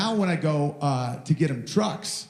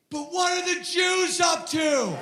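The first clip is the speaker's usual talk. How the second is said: put-on much higher, slow shouted kid question; mock toddler question; 14 semitones higher, slower than usual. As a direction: shout it innocently like a curious toddler. Son voicing dad's ideas.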